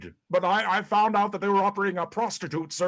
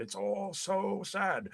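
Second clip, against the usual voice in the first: much softer, slow, droopy; mocking wife's sad sigh; 2 semitones higher, 8 dB quieter, slower than usual. Husband imitating wife Pat moping sadly on phone, softly. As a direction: mimic her moping quietly. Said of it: "I said".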